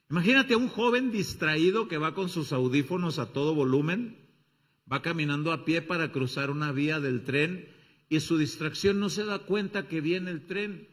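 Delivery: ordinary delivery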